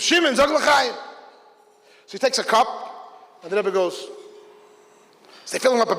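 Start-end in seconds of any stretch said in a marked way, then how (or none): 0.0-0.9 high, loud call across the room; screaming. Retold or imitated. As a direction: call the young Shliach out by name. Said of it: "Rebbe Groner screams".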